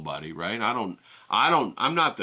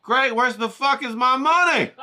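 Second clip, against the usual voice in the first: mock outrage; 12 semitones higher, 6 dB louder than usual. Demanding his payment, laughing.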